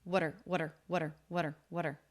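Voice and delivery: water with a quick flapped T, like "wadder". Shown as a demonstration. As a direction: tap the T in water lightly between the vowels. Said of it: "it is a flap T".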